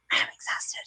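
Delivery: whispered, drained of energy; a tired little whisper to friends; exhausted whisper. Retold or imitated. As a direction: breathe it out like you're spent. Retelling her own tired reply.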